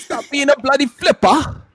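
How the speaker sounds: mock British accent. Mocking British insults.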